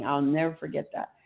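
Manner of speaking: ordinary talk, nothing marked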